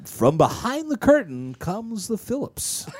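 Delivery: slow dramatic host announcement; game show host. Pretend. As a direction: announce the big entrance. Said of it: "game show host voice".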